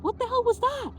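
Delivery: incredulous mock shriek up high; retold disbelief. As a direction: squeak it out in playful indignation. Asking her heart in disbelief.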